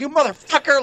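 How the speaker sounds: high, loud angry outburst; mock furious yell. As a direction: curse out the screen. Retelling yelling at TV.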